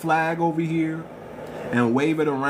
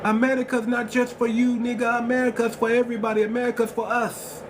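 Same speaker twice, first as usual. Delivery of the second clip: imitating a Jamaican woman, pitched higher; mock Jamaican accent, quick and higher; 9 semitones higher than usual. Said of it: "With her Jamaican accent".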